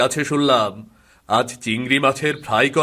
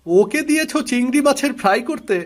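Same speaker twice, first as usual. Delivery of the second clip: mocking joke; 9 semitones higher than usual. Brother mocking sister.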